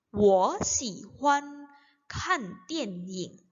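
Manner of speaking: very slow, word by word; slow model reading. Shown as a demonstration. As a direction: pronounce each syllable apart for learners. Teacher models sentence for learners. (contoh baca pelan, kata demi kata)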